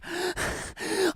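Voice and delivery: high, hammed-up gasp; mock gasp. Imitating the customer's dramatic gasp, hammed-up. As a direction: gasp dramatically like a shocked lady. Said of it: "she's like".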